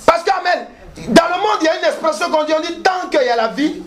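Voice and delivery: high-pitched, urgent preaching; full-force preaching. Acted. Preacher promising the congregation a way out, high fervent preaching. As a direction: drive each phrase out to the congregation.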